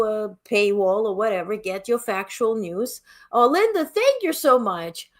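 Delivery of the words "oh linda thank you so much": higher, slowed gush of thanks to Linda; delighted thanks. Real, not acted. Host thanking Linda for the unexpected orange gift, delighted. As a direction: melt with surprised gratitude.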